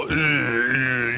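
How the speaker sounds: low barked uh uh; mock dumb cop grunt. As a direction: grunt like a stumped officer. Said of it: "The cops are all".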